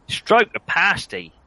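put-on Cornish old man. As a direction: play a withered Cornish farmer. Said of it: "only Cornish".

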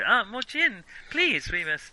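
put-on high, gushing German-accented reunion; loud, effusive German-accented endearments. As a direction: greet a long-lost friend in a German accent. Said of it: "doing a German accent there".

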